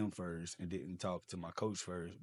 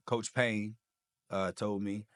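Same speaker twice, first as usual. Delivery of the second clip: ordinary talk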